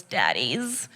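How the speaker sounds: deep, soft, dropped way down; low sultry voice. Acted. Teasing the audience about fathers, low sultry purr. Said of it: "I don't know why I said it in that voice".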